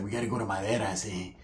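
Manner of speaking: low, very soft cousin reply; imitated hushed mumble; cousin's put-on voice. Voicing cousin's destination.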